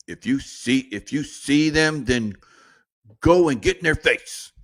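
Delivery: hammed-up call to confront; imitated political rabble-rouser. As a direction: imitate someone egging on a crowd. Imitating Democrats urging people to confront.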